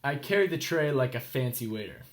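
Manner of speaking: plain reading voice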